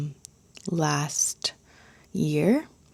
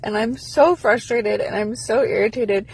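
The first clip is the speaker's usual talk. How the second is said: frustrated near tears; 5 semitones higher than usual. Real, not acted. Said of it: "I wanna cry".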